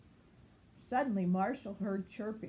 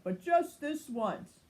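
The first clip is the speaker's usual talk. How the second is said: higher, slow, giving in; just this once; 6 semitones higher, 4 dB louder than usual. A narrator's reading. Marshall agreeing to sleep over, slow and higher. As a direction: draw out the one-time exception. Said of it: "Marshall said".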